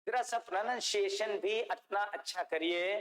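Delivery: usual speaking voice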